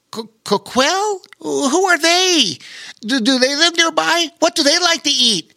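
put-on high childlike Bobo Chico voice. A narrator's reading. Bobo Chico asking Pete eager questions, high childlike voice.

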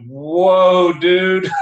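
slow stunned exclamation, played big; retold astonishment. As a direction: stretch it out, bowled over. Retold startled reaction to shouted question.

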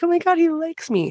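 high-pitched, giddy crush reaction; mock gushing. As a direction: gush like someone with a crush. Retelling own gushing reaction to character.

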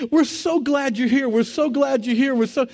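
high, loud, repeated glad greeting; joyful welcoming crowd. Retold or imitated. Friends and neighbors greet the mother, high and glad. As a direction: keep welcoming her over and over. Tunnel of people welcoming her.

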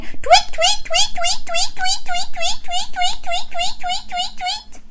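very high, squeaky chirping; tweeting birds. Pretend. Presenter getting children to be birds, high rapid tweeting. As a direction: chirp in a steady high stream. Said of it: "Can you be the birds".

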